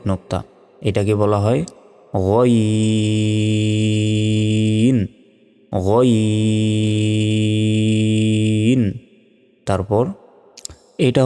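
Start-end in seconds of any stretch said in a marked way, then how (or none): 2.3-8.9 গইন held long and steady; drawn-out ghain. Shown as a demonstration. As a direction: stretch গইন for four counts. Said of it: "চার second টান হবে". (গইন চার সেকেন্ড টেনে)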